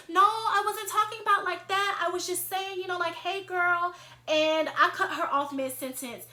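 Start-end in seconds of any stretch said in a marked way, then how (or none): 0.1-3.9 high-pitched protest in her voice; mock-defensive backpedalling, pitched up; imitated defensiveness. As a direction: imitate her hurriedly excusing herself. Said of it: "she immediately gets defensive".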